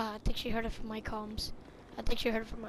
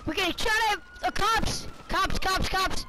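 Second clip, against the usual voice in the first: panicked shouting; 6 semitones higher than usual. Acted. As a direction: yell a warning as bullets fly. Cops shooting at the crew.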